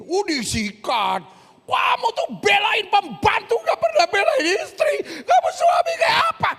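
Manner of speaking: high, loud whiny crying shout; shrieking wife. Retold or imitated. Wife screaming at husband for defending the maid, tearful shout. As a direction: shriek at him like a wronged wife.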